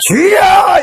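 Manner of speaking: high, loud cry to the Lord; demonstrated prayer cry. Shown as a demonstration. Preacher showing how to call on the Lord, crying out. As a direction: belt it out in one big prayer shout. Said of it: "이렇게 하는 거예요".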